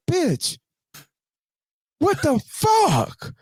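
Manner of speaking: high-pitched, drawn-out incredulous outrage; outraged disbelief. Retold or imitated. Man retelling his reaction to friend's misfire, outraged. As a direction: stretch out the last word, pitched very high. Retold outrage after the shot.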